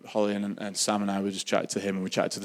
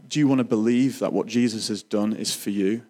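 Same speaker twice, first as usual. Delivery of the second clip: ordinary talk, nothing marked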